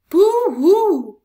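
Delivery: very loud, high wailing hoot; frightened owl hoot. Acted. Owl hooting in terror at the Gruffalo. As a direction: wail like a terrified owl.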